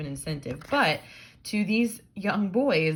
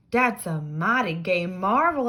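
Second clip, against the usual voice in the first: voiced original line; 3 semitones higher, 7 dB louder than usual.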